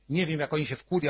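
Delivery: ordinary talk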